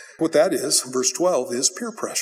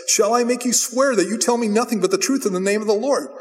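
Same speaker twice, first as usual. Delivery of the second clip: higher-pitched, insistent demand; exasperated king; 6 semitones higher than usual. Acted. King pressing Micaiah for the truth, exasperated. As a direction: press him as if fed up. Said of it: "Micaiah has done it before".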